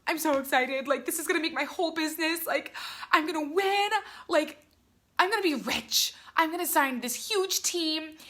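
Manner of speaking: high, giddy imitation of herself; hammed-up excitement. Her past self before first wedding event, hammed-up excitement. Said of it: "I was so excited about these wedding events".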